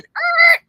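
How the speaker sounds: a high, loud mock groan of something crashing; a quick cartoonish crash sound; imitated crash noise. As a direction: squeal it out like metal hitting metal.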